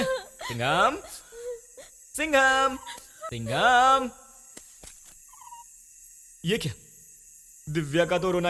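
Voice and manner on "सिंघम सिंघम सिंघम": calling out. Acted. Calling out into the forest.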